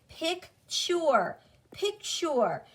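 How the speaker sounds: "picture" as PIK-chur, first split, then joined; blended word. Shown as a demonstration. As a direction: model "picture" with a clear ch. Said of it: "Sound it out with me".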